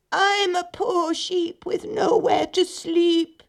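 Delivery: high, slow, drawn-out fake sheep voice; wolf posing as a poor sheep; bleating. A narrator's reading. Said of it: "he bleated".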